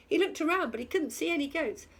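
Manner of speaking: ordinary delivery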